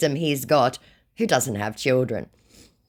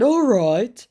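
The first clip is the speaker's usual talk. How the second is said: high, louder mock agreement; scammer's put-on voice; 11 semitones higher, 5 dB louder than usual. Acts scammer's reply.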